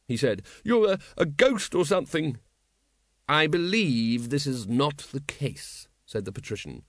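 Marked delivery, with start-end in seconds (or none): 0.6-2.4 high, stammering protest; agitated, higher-pitched voice; frantic denial. Acted. Wonse stammers at the patrician. 3.3-5.9 slow, measured correction; composed, drawn-out delivery; unruffled ruler. Acted. Patrician calmly contradicts Wonse.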